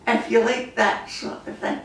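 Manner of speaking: ordinary talk, nothing marked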